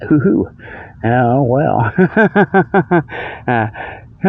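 ordinary delivery

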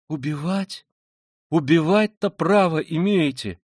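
high, loud, drawn-out protest; horrified Sonya. Acted. Sonya answering the killer's theory, horrified. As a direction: cry out in appalled disbelief. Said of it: "всплеснула руками Соня".

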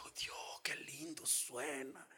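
soft breathy whisper of an angel; imitating an angel in a whisper. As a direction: hush it like a sweet angelic voice. Retelling possessed woman's angel voice.

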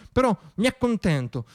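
normal talking voice

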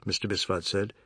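ordinary delivery

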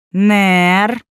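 drawn-out, loud, mock-menacing call; sinister drawl. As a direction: stretch the name out like a playful threat. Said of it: "неожиданно зловеще протянула Далия".

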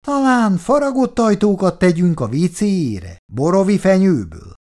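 high, loud sarcastic question; emboldened. A narrator's reading. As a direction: pipe up with newfound cheek. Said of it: "bátorodott neki Péteri". (felbátorodva, magas hangon)

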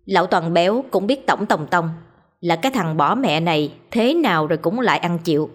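usual speaking voice

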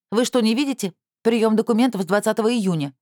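indignant. A narrator's reading. Secretary indignantly rebuffs the visitor. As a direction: scold the pestering visitor. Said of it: "возмутилась она".

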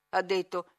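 plain reading voice

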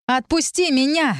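high, drawn-out demand; cried out. A narrator's reading. Heroine pinned under Lir, crying out. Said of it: "крикнула я".

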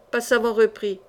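ordinary delivery, nothing marked

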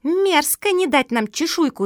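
high, loud indignant gabble; chattering child. Acted. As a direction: rattle it off like an outraged little girl. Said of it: "затараторила Куки".

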